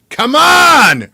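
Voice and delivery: impatient mentor urging; urging call. A narrator's reading. Emil urging the reader on.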